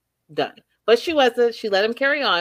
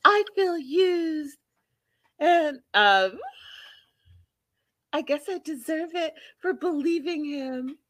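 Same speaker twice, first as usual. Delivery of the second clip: Debbie impression; 8 semitones higher than usual. Acting out a used woman pitying herself, high plaintive voice. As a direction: draw it out high and pitiful. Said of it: "And then she's like".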